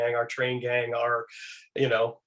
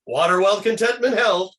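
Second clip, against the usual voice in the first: louder proclaimed toast, pitched up; 6 semitones higher, 6 dB louder than usual. Retold or imitated. Raising a cocktail to the director, loud proclaimed toast. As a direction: raise the glass and announce it.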